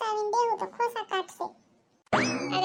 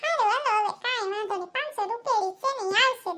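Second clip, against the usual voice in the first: normal talking voice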